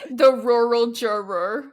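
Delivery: slow "rural juror" with every R laboured; tongue-twisted, mock-American "rural juror"; pushing through R's. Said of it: "She had to really push through it".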